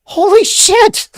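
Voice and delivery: high, loud, drawn-out holy shit; shocked outburst. Real, not acted. Reviewer shocked by the movie's line, loud high disbelief. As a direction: react in real disbelief, way up high.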